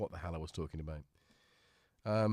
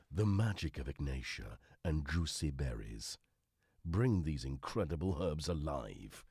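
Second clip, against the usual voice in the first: low, grand product-blurb read; advert voice-over; 4 semitones lower than usual. Pretend. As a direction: read the packet like a dramatic advert. Reads packet like an advert.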